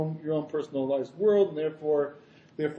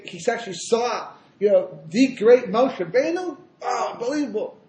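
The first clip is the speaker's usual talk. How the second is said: higher, swelling loud then marvelling; acted amazement; 6 semitones higher, 6 dB louder than usual. Chassidim marvelling at the great revelation, excited awe. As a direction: play followers bowled over by wonder.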